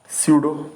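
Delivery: स्यूडो said syoo-doh, no p sound; pseudo with silent p. Shown as a demonstration. Teacher models स्यूडो for students, p silent. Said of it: "पी silent word रहता है".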